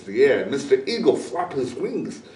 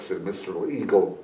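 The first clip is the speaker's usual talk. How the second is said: usual speaking voice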